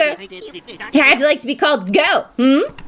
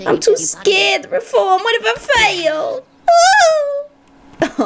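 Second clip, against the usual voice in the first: crying; 9 semitones higher, slower than usual. Acted. Scared character fears failing.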